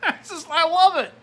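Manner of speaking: high, loud laughing delight. Real, not acted. Laughing at the voter's excuse, high and loud. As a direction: laugh it out in disbelief.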